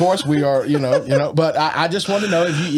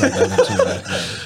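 normal talking voice

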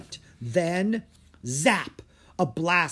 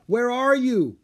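high, loud searching shout; calling out; 11 semitones higher, 8 dB louder than usual. A narrator's reading. Drake all alone searching for his dragon, calling out. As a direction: call far across the field. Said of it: "Drake called out".

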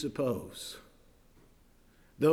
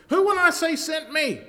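high, loud, anxious question; pleading Moses; 14 semitones higher, 8 dB louder than usual. Acted. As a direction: beg for an answer.

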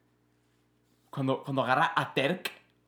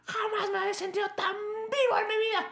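very high, crying-happy squeal; a high, teary elephant gushing about feeling alive. Acted. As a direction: gush with teary joy. Voicing elephant euphoric.

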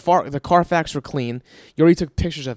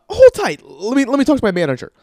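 mock chirpy salesman; 10 semitones higher, 7 dB louder than usual. Mocking the salesman.

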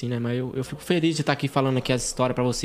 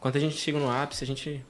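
normal talking voice

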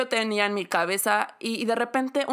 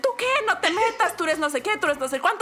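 mock scolding; 8 semitones higher than usual. Mom or brother telling her to stay out, scolding. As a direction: snap back as the annoyed family.

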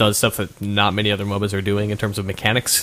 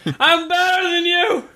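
much higher, slow gloating cheer; gloating victory; 3 dB louder, slower than usual. Pretend. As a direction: crow like you just won. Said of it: "sound like a drunk".

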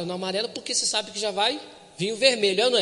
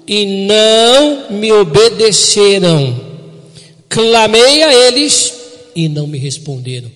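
loud, slow, weighty reading of the verse; preaching scripture; 2 semitones higher, 13 dB louder, slower than usual. Acted. Pastor reads the sentence to his congregation, loud and slow. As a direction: proclaim every word like a verdict.

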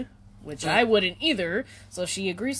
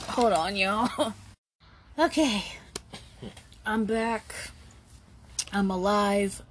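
recovering from coughing. Real, not acted. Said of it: "I coughed up a lung".

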